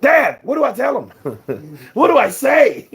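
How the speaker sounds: put-on high, loud voice of a kid asking his father; imitating a flustered kid. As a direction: play a nervous young fighter asking dad for help.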